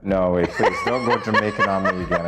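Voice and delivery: normal talking voice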